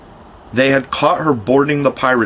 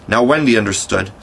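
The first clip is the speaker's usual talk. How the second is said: ordinary delivery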